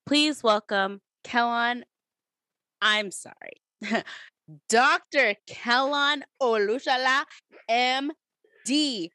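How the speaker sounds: grand entrance announcement. Acted. Introducing guest grandly.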